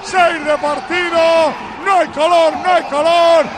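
slow, drawn-out celebratory announcing; goal celebration. Real, not acted. Commentator rallies listeners after second goal, drawn-out triumphant calls. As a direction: proclaim the goal phrase by phrase, stretching each ending.

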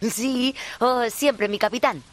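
fawning pirate sidekick voice; bumbling sidekick. Acted. Co-host plays the bumbling first mate.

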